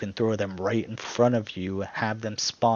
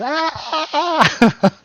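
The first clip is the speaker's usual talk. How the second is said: very high, loud mock scream; little girl scream; 9 dB louder than usual. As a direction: shriek like a small child, hammed up. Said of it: "screaming at the top of their lungs like a, a, a small little girl child".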